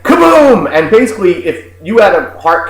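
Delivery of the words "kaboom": loud, high exploding 'kaboom'; imitated surprise explosion; cannon blast imitation. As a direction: boom it out suddenly like a cannon. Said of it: "It was like".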